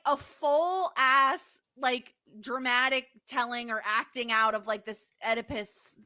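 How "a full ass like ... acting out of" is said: ordinary talk, nothing marked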